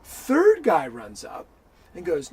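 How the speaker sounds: normal talking voice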